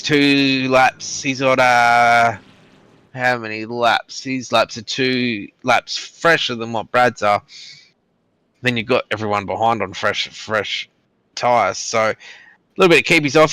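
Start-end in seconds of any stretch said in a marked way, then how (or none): none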